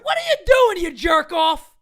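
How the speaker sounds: high, laughing-crying exasperated yell. Real, not acted. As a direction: yell in real disbelief at the manager. Yelling at the manager.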